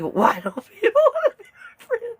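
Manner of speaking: very high, silly mock-pleading voice; weird voice. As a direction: squeal it through laughter in a weird voice. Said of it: "It's the weirdest voice on the planet".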